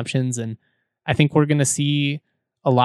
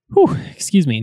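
catching breath after drinking; 4 semitones higher, 5 dB louder than usual. Real, not acted. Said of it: "needed some water there".

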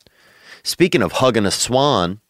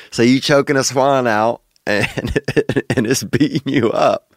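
real giggles between the words; laughing; 3 semitones higher than usual. Real, not acted. Host recapping the caller's swan fight, laughing. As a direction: struggle to get the recap out through laughter.

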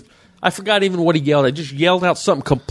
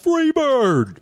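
mock heckler yell; 11 semitones higher than usual. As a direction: heckler shouting a request at the comic. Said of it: "the same guy yells out".

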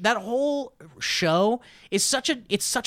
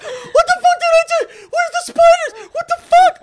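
shocked laughing shout; 10 dB louder than usual. Retold or imitated. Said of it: "And you're sitting there like".

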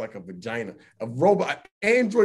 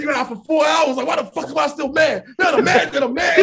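loud, raised-pitch mock fury; exasperated rant; 5 semitones higher, 3 dB louder than usual. Man replays four hours of being mad, loud exasperated rant. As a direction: shout it in baffled exasperation.

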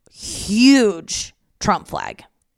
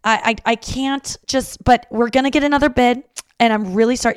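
higher, louder, fretful apologizing; apologetic mom; 5 semitones higher, 5 dB louder than usual. Retold or imitated. Mom apologizing to the AC repair guy, higher and louder. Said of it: "She just kept apologizing".

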